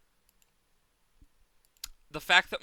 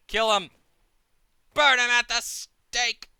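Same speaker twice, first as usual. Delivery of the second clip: battle cry; 7 semitones higher, 8 dB louder than usual. Acted. Rallying golems.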